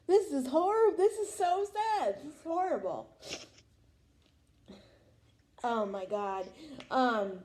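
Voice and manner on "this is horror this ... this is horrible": mock horrified. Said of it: "I was like".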